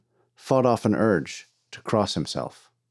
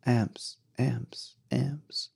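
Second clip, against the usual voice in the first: fading, much softer repeats of one word; echo; 2 semitones higher, 8 dB quieter, much slower than usual. A narrator's reading. The shouted word echoing in the depths, fading echo. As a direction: imitate an echo dying away in a mine. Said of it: "The word echoed in the depths of the mine".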